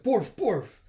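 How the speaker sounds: high, loud spoken woof; mock dog bark. Dog barks.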